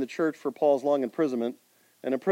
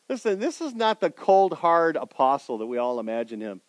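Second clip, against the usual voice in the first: ordinary talk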